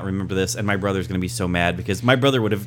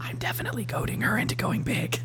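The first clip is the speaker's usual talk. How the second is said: conspiratorial whisper into the mic; giggly prank whisper; 12 semitones higher, 8 dB quieter than usual. Real, not acted. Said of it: "whispering into the mic".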